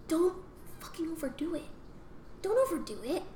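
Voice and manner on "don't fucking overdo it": whispered warning. Real, not acted. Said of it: "advice on hitting kids".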